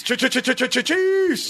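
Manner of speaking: repeated ch- rising to a high Chiefs; stuttered buildup. Pretend. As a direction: stammer ch- ch- then pop Chiefs high. Stuttering buildup to team name.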